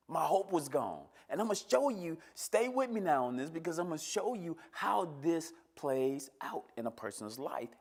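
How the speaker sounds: high, loud, driving testimony; impassioned preaching. Real, not acted. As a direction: preach it with mounting urgency. Preaching at raised force.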